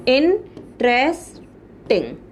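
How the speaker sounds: Interesting slowly split, long pauses between the parts; slow, separated beats in interesting; broken into syllables. Shown as a demonstration. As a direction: sound out interesting piece by piece, very slowly. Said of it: "इनको break करके".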